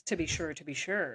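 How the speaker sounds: low, soft mock Irish lilt; a hint of an Irish brogue, dropping low; Irish accent. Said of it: "As my Irish heritage suggests".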